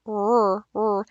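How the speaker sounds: r with the tongue slightly back; "r" said as a pure consonant; consonant r sound. Shown as a demonstration. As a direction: hold a bare "r", soft and short. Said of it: "slightly back for the r".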